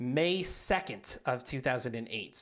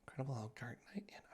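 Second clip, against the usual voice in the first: whisper; 5 semitones lower, 18 dB quieter, much faster than usual. Real, not acted. Whispered aside.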